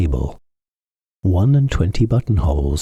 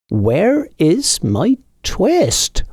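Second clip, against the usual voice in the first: higher, slow pleading question; anxious old tailor; 11 semitones higher, much slower than usual. A narrator's reading. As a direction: plead slowly with the cat. Tailor asks again.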